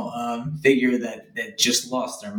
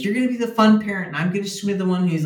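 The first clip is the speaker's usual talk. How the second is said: quick, higher half-mocking complaint; 7 semitones higher, much faster than usual. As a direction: imitate the wife grumbling fast. Wife upset about parenting roles.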